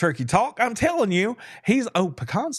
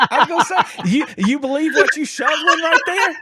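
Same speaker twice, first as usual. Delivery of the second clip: high, loud put-on voice; higher, louder mock scold to a co-host; mock accusing voice; 10 semitones higher, 5 dB louder than usual. Teasing voice mocking Herb.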